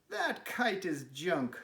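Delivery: high, slow, scornful robin voice; mocking robins. Robins scorning Toad's kite, high and slow. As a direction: sneer down at the broken kite.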